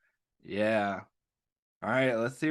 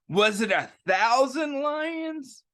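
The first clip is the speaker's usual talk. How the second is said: put-on excited kid shout; 8 semitones higher than usual. A narrator's reading. Reading to children, kid shouts question at Joe. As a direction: blurt it out in excitement.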